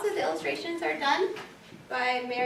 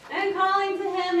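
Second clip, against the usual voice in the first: higher, louder repeat; raised, building narrator voice; insistent storytelling; 7 semitones higher, 5 dB louder than usual. A narrator's reading. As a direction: lift it as the call grows stronger. Storytelling, repeated call, growing stronger.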